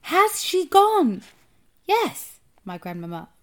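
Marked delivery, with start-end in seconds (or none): 0.0-1.2 high, slow, very loud question; shouting. A narrator's reading. Boy in the tree asking grandmother anxiously, shouting. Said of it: "I shouted again". 1.8-2.4 high, loud one-word call. A narrator's reading. Grandmother answering the boy in the tree, calling up.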